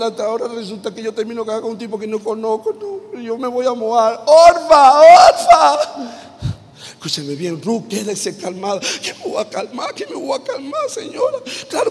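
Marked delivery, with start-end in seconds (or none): 4.2-5.8 high, loud call across the house. Acted. Ruth calling loudly for Orfa. 8.8-11.9 much higher, half-laughing, half-crying whine; whining protest. Acted. Ruth whining at her mother-in-law, high and tearful.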